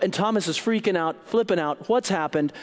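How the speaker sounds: ordinary talk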